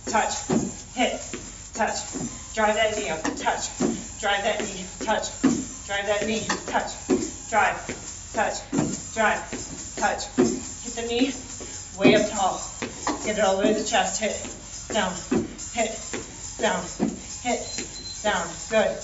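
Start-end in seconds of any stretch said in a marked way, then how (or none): none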